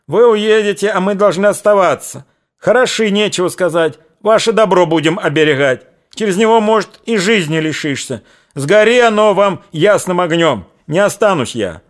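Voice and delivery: high, loud wailing protest; shrill outcry. A narrator's reading. Darya wails at the men about staying behind, loud high protest. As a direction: cry out your complaint at the men. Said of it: "загласила Дарья".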